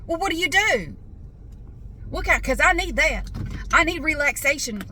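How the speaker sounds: mock an over-keen small-talker; eager nosy caller. Siding guy pressing customer about relaxation videos, high mock eagerness.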